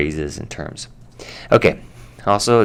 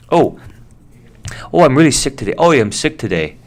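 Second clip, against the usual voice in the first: a light, bad Irish accent, louder at first; Irish impression; 7 semitones higher, 4 dB louder than usual. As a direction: do a lightly voiced Irish coworker complaining. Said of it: "bad Irish impression".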